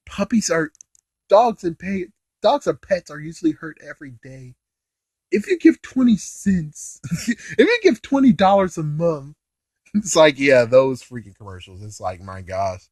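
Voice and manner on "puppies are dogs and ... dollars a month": soft, higher mock-pleading appeal; sad charity commercial. Imitating a hurt-puppy donation commercial, mock pleading. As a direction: plead softly like a parody donation ad. Usual freaking damn commercial.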